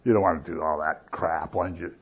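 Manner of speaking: low, hushed coaxing; tempting voice. Retold or imitated. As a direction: play the voice that is not his, low and soft. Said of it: "you hear a voice that says".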